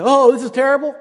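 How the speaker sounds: mock dismay. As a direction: play a fussy soldier moaning. Mock complaint of paratroopers.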